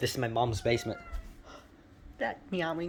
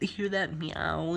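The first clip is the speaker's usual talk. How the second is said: ordinary delivery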